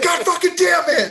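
high, loud hammed-up outburst; mock frustration. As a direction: curse like a kid who blew the shot. Kid misses the pretend shot.

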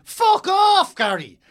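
high, drawn-out shout of derision; exasperated yell. Acted. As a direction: bellow it at the TV pundit, stretching each word high. Ranting back at imagined TV pundit.

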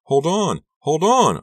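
high, loud urgent cry; desperate shout; cried out. A narrator's reading. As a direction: yell for her to hang on. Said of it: "I cried".

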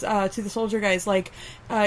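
normal talking voice

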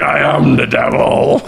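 a hint of a mock-demonic boast; Wildman. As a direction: proclaim it like a hammed-up villain. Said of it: "he's just like".

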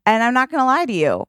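ordinary delivery

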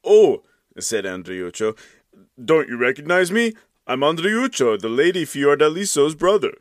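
a hint of an eager young man; pleading Andreuccio. A narrator's reading. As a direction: call up hopefully to the window.

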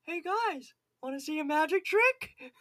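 high-pitched mocking impression of a suspect; silly high voice pitching a trick. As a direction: pitch it way up like a goofy showman. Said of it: "in the interrogation room going".

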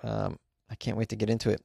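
ordinary talk, nothing marked

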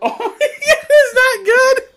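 high, loud, slow laughing retch; laughing and gagging at the stench; disgusted by smell. Real, not acted. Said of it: "Smells so bad".

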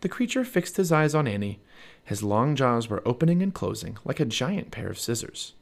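ordinary delivery

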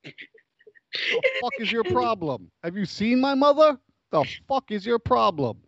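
high, indignant mobster outburst; Robert De Niro. Acted. Mob patient to psychiatrist about his mother, indignant. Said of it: "talk to Robert De Niro".

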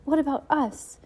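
high-pitched appeal in horror; horrified. A narrator's reading. Klaus begs his aunt to remember them, horrified. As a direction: demand it, aghast, voice jumping up. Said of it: "Klaus said in horror".

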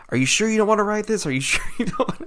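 high, quick mock pleading; pleading dad. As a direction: imitate a dad desperately double-checking, laughter creeping in. Disappointed dad pleads with his daughter.

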